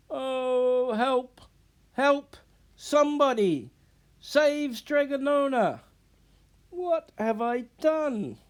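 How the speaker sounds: high, loud, frantic calls for help; panicked cries for help. Acted. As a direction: shout for help as if in real danger.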